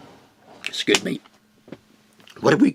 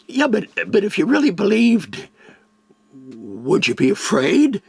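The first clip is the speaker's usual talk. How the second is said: challenging doubter; 11 semitones higher, 6 dB louder than usual. Acted. Voicing a doubting questioner.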